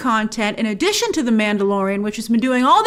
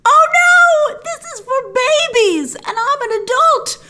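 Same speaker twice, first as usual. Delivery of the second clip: higher, loud mock-panicked shout; 13 semitones higher, 4 dB louder, slower than usual. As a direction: shriek in hammed-up horror, like a grown-up stuck at a kids' film. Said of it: "I was like".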